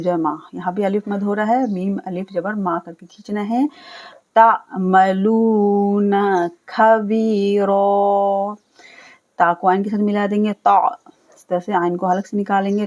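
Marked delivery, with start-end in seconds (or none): 4.4-8.5 तामलूना with the लू held long; slow recitation, long लू and aa; drawn-out lu. Shown as a demonstration. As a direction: stretch the लू in तामलूना. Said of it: "लू को खींचना है".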